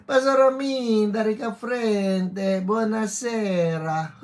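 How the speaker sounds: high, loud mock-Italian greeting; Italian accent. Host greeting a pastor friend online, putting on an Italian accent. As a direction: call out in a playful Italian accent. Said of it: "I love my Italian accent".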